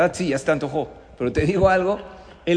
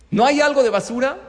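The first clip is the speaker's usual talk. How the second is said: insistent repeat; 8 semitones higher, 8 dB louder than usual. Retold or imitated. Son asks mother again, insistent.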